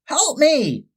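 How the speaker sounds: a mild storybook shout for help; seagull shouting. A narrator's reading. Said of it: "shouted the seagull".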